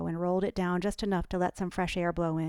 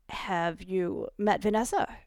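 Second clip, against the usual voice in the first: sputtering; slower than usual. A narrator's reading. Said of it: "he sputtered".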